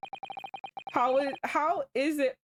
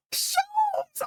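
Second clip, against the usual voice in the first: put-on shrill-pitched girlish gasp then name call; high excited gasp and cry. Girl spotting Sholmes in court, excited high gasp.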